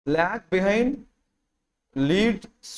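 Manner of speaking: slow, word by word so they can write; slow dictation. Shown as a demonstration. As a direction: spell out lag behind slowly for notes. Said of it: "రాసుకోండి". (నెమ్మదిగా dictation)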